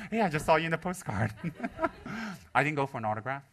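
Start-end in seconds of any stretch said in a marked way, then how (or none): 0.1-2.6 loud, high, laughing call; laughing quip. Retold or imitated. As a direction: tease him through a laugh. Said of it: "And I was like".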